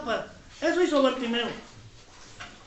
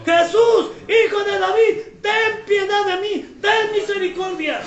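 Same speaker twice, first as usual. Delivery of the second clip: acted insistent shout; 6 semitones higher than usual. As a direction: keep crying out despite being silenced. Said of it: "pero yo sigo gritando". (grito de súplica actuado, agudo e insistente)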